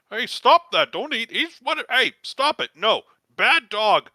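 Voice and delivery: high, loud scolding yell; yelling at a dog. Acted. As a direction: shout at the dog to stop. Cultist yelling at hellhound.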